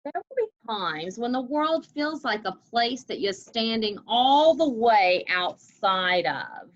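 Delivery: storybook reading, slowing at the end; story reading. Acted. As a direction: draw the last words out for the kids. Teacher reads picture book aloud.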